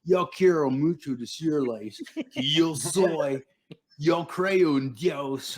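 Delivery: high, very slow Southern drawl in Spanish; Southern-accented Spanish. Retold or imitated. Mission companion testifying in Spanish, strong Southern accent. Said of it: "he had a Southern accent".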